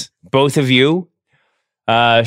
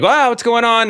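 surprised guards; 12 semitones higher than usual. Retold or imitated. Guards confused in imagined scene.

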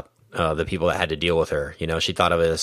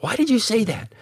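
quoting her much higher, half-hearted indignation; mock high voice. Retelling the mom's line to co-hosts in a mock high voice. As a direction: squeak it out in mock shock.

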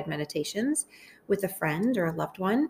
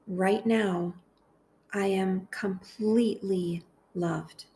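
guided meditation. Acted. Host praying phrases aloud for listeners to repeat, guided meditation. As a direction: lead them gently through it, phrase by phrase, unhurried.

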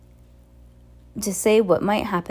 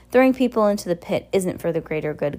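ordinary delivery, nothing marked

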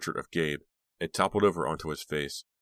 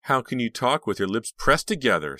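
higher, louder taunt; nasty reply; 6 semitones higher, 6 dB louder than usual. A narrator's reading. Sarah taunting the kissing couple, nastily. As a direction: needle them nastily, pitch raised. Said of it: "Sarah replied nastily".

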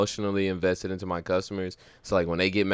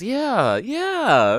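much higher, sing-song fake agreement; fake customer service yeah; 4 dB louder than usual. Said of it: "I hate doing that fake ass".

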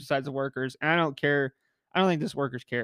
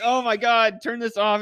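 embarrassed outcry; 5 semitones higher, 5 dB louder than usual. Retold or imitated. Friends embarrassed by noises.

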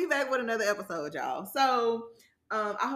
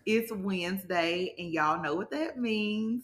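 ordinary delivery

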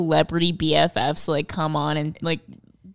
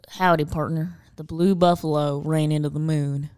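Southern cowboy accent; 4 semitones lower than usual. Shown as a demonstration. Co-host switches accents for the test phrase, Southern cowboy accent. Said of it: "turns into something Southern".

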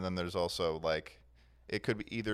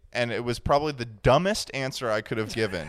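louder, laughing through it; amused self-mockery, laughing; 3 semitones higher, 8 dB louder than usual. Real, not acted. Laughing at own answer.

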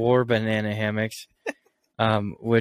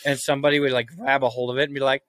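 ordinary delivery

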